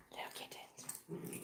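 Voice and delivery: soft whisper to the kittens. Real, not acted. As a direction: breathe it in a hush.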